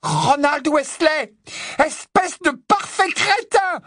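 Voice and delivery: slow, high, furious shout; fureur martelée. Acted. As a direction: punch out each word at a shriek. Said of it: "Elle ponctua chaque mot d'un nouveau coup". (colère hurlée, chaque mot martelé)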